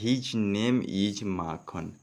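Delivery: each word read slowly and clearly; slow dictation pace; slow reading aloud. Shown as a demonstration. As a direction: read the line slowly so students follow. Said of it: "next word क्या है".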